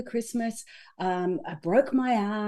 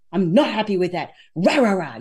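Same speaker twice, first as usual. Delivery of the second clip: mock angry grumble; 4 semitones lower, 6 dB louder than usual. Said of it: "expressing it as anger".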